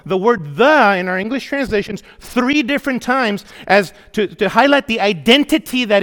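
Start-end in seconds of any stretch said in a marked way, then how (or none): 0.6-1.0 "the" punched loud; stressed the. Shown as a demonstration. Pastor pointing out the Greek article, with a loud punch on "the". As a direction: hit "the" hard and clean. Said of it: "he uses the definite article".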